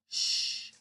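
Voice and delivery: soft shush; quiet shh. Acted. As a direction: hush secretly. Monkey hushing.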